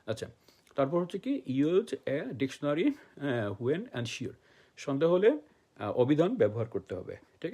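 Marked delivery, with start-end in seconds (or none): 1.5-4.3 very slow, word by word, like dictating to a class; slow dictation. Shown as a demonstration. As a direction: spell each word out slowly for learners. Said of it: "teacher dictating key phrase". (ধীরে ধীরে শিক্ষক শ্রুতিলিখন করাচ্ছেন)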